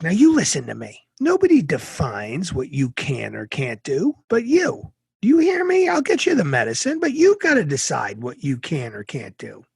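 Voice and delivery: mother firmly telling son he decides; stern mom. Retold or imitated. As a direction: grip him and make him listen.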